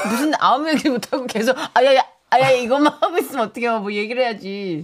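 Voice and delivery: mock the stammering, then nag; exasperated scolding. 답답해서 팀장을 타박. (답답해서 타박하는 말투)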